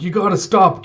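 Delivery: high, loud call to stop; mock urgent shout; urgent plea. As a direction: beg a friend to quit fighting. Denjiro pleading.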